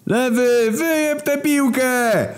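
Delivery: screaming coach in the stands, slow and very high; roaring from the stands. Acted. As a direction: roar it across the whole stadium. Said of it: "ryczał z trybun".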